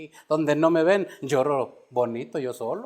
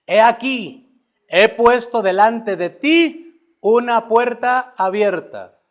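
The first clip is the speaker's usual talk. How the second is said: louder, slow, solemn proclamation; scripture read as God's voice; 5 semitones higher, 7 dB louder, slower than usual. Acted. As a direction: declare it as the Lord speaking to the church. Reads God's words to the church.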